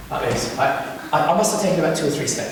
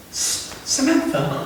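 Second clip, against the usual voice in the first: baffled echo; 7 semitones higher, 5 dB quieter, slower than usual. Retold or imitated. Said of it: "And I went".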